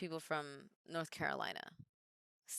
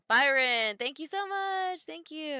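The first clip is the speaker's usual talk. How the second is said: excited; 9 semitones higher, 6 dB louder, slower than usual. Real, not acted. Excited thanks to chatter.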